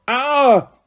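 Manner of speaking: high startled cry; startled gasp. Acted. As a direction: gasp in surprise at a bang. Twins apparate with loud cracks.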